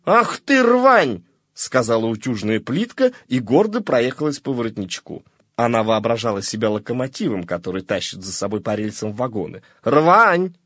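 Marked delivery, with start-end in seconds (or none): 0.1-1.2 much higher, louder scornful outburst; scornful cry. A narrator's reading. The iron lashes out at the collar, much higher and louder. 9.8-10.6 louder, higher scornful "Рвань"; scornful repeat. A narrator's reading. The iron repeats its insult to the collar, louder. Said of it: "повторила она".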